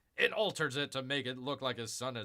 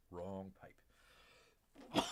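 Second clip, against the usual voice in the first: softer after coughing; hoarse quieter wrong pipe; quiet strained repeat; 7 semitones lower, 11 dB quieter than usual. Real, not acted. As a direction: mutter it recovering.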